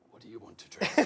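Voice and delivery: low, soft gruff biker voice; menacing biker. Retold or imitated. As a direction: voice the huge biker, low and quiet. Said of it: "He goes".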